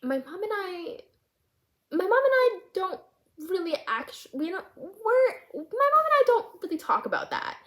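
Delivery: high, halting false starts; awkward hesitation. Retold or imitated. Student admitting to counselor about mom, awkward and halting.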